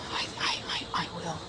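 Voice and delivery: stammering terrified whisper; scared stiff, whispering; frightened whisper. Real, not acted. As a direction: barely breathe the words out. Frightened alone in dark woods.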